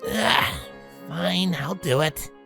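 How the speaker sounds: reluctant, drawn-out concession; sighing surrender. A narrator's reading. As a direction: give in with a groan. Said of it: "Rainbow Dash sighed".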